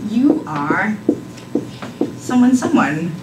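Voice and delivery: usual speaking voice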